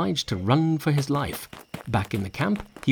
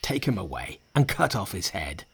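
low, weighty disdain; contemptuous; 5 semitones lower than usual. A narrator's reading. Commander condemning the spirit who humiliated him, contemptuous. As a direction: pronounce sentence with restrained scorn. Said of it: "looking contemptuously at the spirit".

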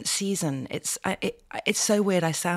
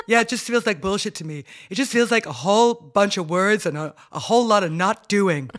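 son's voice; 4 dB louder than usual. Retold or imitated. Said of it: "I love you doing his accent".

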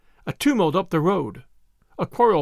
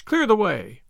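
raised, urgent call; crying out; 3 semitones higher than usual. A narrator's reading. Voices on the crowded road, mildly crying out. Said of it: "cried the voices".

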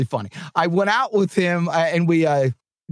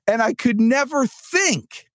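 ordinary talk

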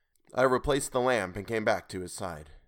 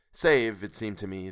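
ordinary delivery

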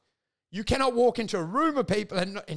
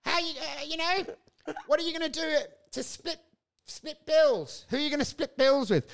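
mocking worried advisers; 7 semitones higher than usual.

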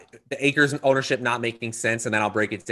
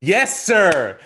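jubilant, punchy praise; excited congratulation; 3 semitones higher, 7 dB louder, slower than usual. Real, not acted. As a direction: celebrate a buddy's correct guess at full voice. Celebrating the correct movie guess.

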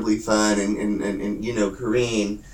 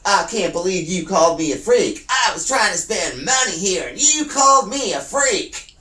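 angry shouting; 7 semitones higher than usual. Retold or imitated. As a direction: yell it, offended and furious. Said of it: "And she came back in".

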